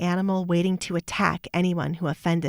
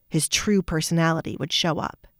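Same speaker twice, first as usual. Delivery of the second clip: usual speaking voice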